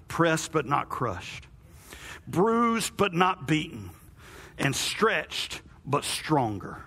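slow, weighty preaching triad; slow emphatic preaching. Acted. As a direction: lay each phrase down slowly.

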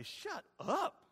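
high but held-back mock annoyance; exasperated aside. Mock complaint to noisy neighbour.

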